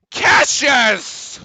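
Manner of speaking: high, loud call of a player; shouting a name. Real, not acted. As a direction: yell his name across the court.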